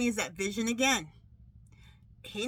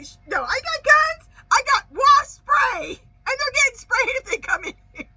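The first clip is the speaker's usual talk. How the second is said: high, loud yell breaking into laughter; panicked shout; 13 semitones higher, 10 dB louder, slower than usual. Retold or imitated. Re-enacting panicked answer again.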